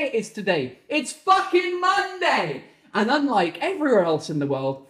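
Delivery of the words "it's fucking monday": high, drawn-out yell; explode, stretching the words slowly; tantrum. Real, not acted. Ranting that the bank is shut, exasperated yell. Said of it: "Tantrum over".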